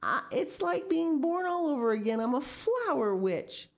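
high, elated rush of feeling; joyful exclaiming. A narrator's reading. Miss Pinchin rejoices as flower witch, joyful exclaiming. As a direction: exclaim as if born anew. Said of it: "exclaimed Miss Pinchin".

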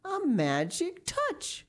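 high, slow amazed repeat; dawning wonder. A narrator's reading. Miss Pinchin realises what she said, dawning wonder. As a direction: stretch it out high as realization dawns. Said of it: "she said again".

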